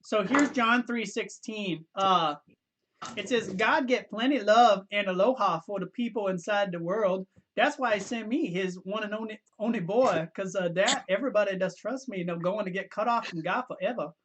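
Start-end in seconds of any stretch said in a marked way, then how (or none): 3.5-14.1 put-on lilting island Pidgin accent, a bit Jamaican-sounding; Hawaiian Pidgin accent. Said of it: "Do it in an accent again".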